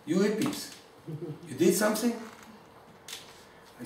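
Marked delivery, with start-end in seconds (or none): 0.1-2.1 put-on higher, curious old rebbe voice; rebbe asking, higher. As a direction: ask it like a skeptical old rebbe. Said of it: "He says".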